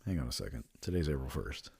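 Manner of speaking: quiet suspicion. Retold or imitated. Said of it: "I was like".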